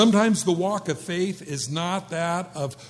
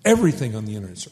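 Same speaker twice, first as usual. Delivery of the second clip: lower, very fast sarcastic aside; 8 semitones lower, much faster than usual. Real, not acted. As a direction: toss it off mockingly. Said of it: "sarcastic echo".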